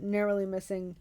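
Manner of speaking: plain reading voice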